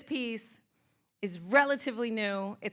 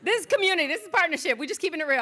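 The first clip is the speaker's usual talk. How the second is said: much higher and louder, beaming; excited exclaim; 6 semitones higher, 5 dB louder, faster than usual. Real, not acted. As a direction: cheer the community on. Excited affirmation to audience.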